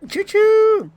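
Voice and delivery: shrill choo choo like a toy train; high train whistle imitation. Boost train in the stream.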